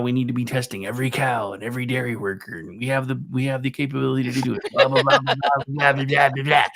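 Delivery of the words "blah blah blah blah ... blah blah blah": loud mocking blah blah; dismissive blah blah. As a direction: brush it off mockingly.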